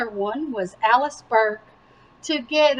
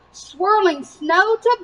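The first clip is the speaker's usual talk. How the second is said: ordinary delivery, nothing marked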